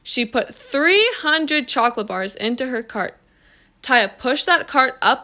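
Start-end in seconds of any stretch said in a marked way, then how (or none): none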